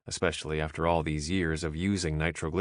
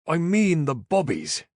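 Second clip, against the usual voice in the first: slow, higher Irish brogue, exasperated; agitated; 8 semitones higher, 4 dB louder than usual. A narrator's reading. Caleb correcting the Yank, agitated Irish brogue. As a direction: spell it out like they're slow. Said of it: "this time in agitation".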